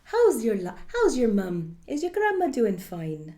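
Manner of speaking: lilting Irish mum asking after the family; chatty Irish mother, light and caring; motherly Irish accent. Acted. Actor showing a motherly Irish chat, in an Irish accent. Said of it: "that very motherly communication".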